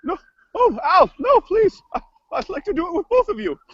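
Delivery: high, fluttering plea; kooky cook character, pleading. Acted. Cook begs weatherman not to leave, high kooky voice. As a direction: beg in an affected, theatrical voice. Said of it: "crazy voices".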